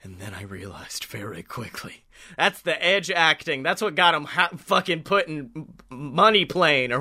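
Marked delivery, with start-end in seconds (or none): 0.0-2.0 low, grim and much softer; grim voice drop. Retold or imitated. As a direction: drop into a grave, heavy tone. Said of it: "the grim drop in your voice".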